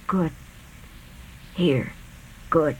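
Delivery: faint, fading last words; dying whisper. A narrator's reading. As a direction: breathe the words out weakly. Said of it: "hoarse shudder".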